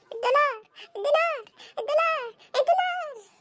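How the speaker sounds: put-on high kid voice, climbing with each repeat; whining child. Child badgers father about the dollar, whining. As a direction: chant it like a pestering child.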